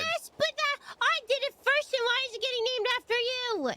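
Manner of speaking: high-pitched aggrieved complaint; indignant protest. Real, not acted. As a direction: object hotly, voice pitched right up. Protests another getting the credit.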